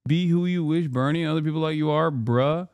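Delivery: ordinary talk, nothing marked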